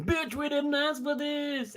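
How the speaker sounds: imitating a complaining viewer, drawn out; mock whiny complaint. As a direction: drag it out like a fed-up viewer. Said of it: "one single person being".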